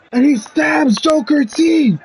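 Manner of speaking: high, drawn-out excited announcement; shocked play-by-play call. Acted. Storyteller reveals Ragnar's surprise attack, shocked and excited. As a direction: hit the twist high and stretch it.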